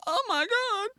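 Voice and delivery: high, soft, strained "oh my God"; a desperate mum choosing; squeezed, anguished cry; high strained gasp. Retold or imitated. Mum's split decision.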